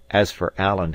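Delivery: ordinary delivery, nothing marked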